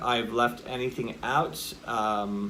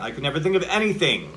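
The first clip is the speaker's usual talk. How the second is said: "anything" with raised energy and a falling pitch; exclamation point; 4 semitones higher, 4 dB louder, faster than usual. Shown as a demonstration. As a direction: exclaim it, higher and quick, energy held up on "anything". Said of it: "an exclamation point is an up energy".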